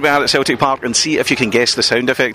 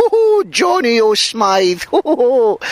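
hammed-up stage Irish accent, much higher and slow; mock Irish accent; 10 semitones higher, much slower than usual. Mocking fans who suddenly turn Irish, hammed-up Irish accent. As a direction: send up a fake Irish fan in a hammed-up Irish accent. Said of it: "fans suddenly become Irish".

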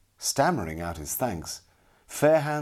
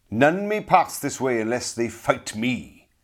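loud, raised, harsh challenge; harsh cry; 5 semitones higher, 6 dB louder than usual. A narrator's reading. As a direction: cry it out as a threat. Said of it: "he cried harshly".

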